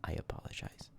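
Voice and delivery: whispered mock apology; barely voiced, very soft apology; hushed apology. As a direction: drop to a tiny, sheepish whisper. Mock apology for bad accent.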